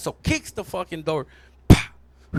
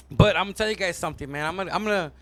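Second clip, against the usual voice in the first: normal talking voice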